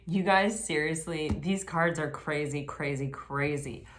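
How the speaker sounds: ordinary delivery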